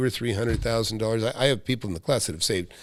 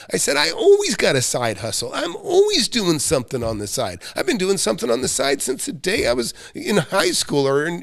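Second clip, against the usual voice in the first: higher, louder heated comeback thrown back in his face; heated retort; 7 semitones higher, 3 dB louder than usual. Retold or imitated. Boss firing back at a complaining employee, heated and loud. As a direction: snap back at the lazy worker, fired up. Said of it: "I jumped back in his face".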